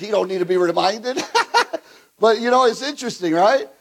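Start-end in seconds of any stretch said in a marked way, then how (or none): 1.3-1.8 a quick high burst of laughter; short high laugh. Real, not acted. Preacher laughs at Moses reminding God.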